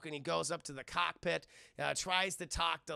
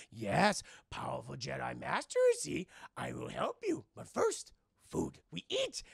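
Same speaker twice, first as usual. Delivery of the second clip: little goblin man; 5 semitones lower than usual. Retold or imitated. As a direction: swoop in pitch like a quirky little sage. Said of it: "the little goblin man's like".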